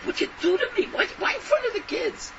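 wife, crying in the next room, asks her husband why; hurt wife pleading. Retold or imitated. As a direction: plead softly like a wronged wife in tears. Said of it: "sie weint".

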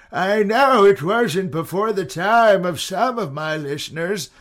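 put-on old man voice. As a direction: play a grumpy old man teasing. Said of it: "I do the old man voice all the time".

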